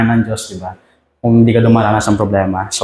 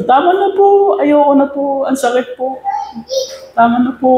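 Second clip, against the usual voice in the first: much higher tearful begging; crying teacup. A narrator's reading. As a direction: plead in pain, near tears. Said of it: "Umiyak siya, sabi niya". (mataas at umiiyak na pagmamakaawa)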